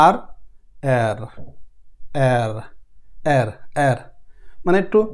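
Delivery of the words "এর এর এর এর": এর with a trembling, rolled র; এর with a shaky, rolled ending. Shown as a demonstration. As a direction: roll the র in এর. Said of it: "কাপা, কাপা ভাব".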